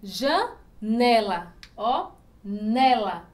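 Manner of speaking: "Janela" very slowly split, NE long and strong; stress on NE. Shown as a demonstration. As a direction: isolate "nela", weight on NE. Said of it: "penúltima sílaba". ("Janela" bem devagar, com força no -ne-)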